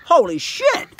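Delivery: high startled "holy shit"; surprised exclamation. Real, not acted. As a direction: blurt it out in shock. Finds worm juice in farm.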